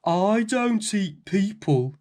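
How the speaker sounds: slow, loud, gentle giant; big giant voice. A narrator's reading. Giant tells the frightened girl he won't eat her, booming and slow. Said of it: "said the big voice".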